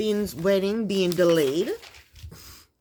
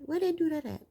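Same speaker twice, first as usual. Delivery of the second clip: much higher, softer borrowed slang; imitated slang catchphrase; 8 semitones higher, 5 dB quieter than usual. Vlogger checking how they say it, high soft slang imitation. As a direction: tentatively mimic how they say it. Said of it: "Is that how they say it?".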